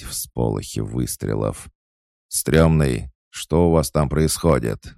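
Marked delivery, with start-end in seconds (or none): 2.3-4.9 raised, higher angry voice; angry demand. A narrator's reading. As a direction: demand an answer over the radio, crossly. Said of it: "злобно произнёс бандит".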